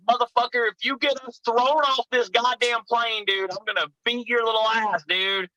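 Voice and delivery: high-pitched mock warning; heated threat. Teller warning friend on the plane, mildly heated threat.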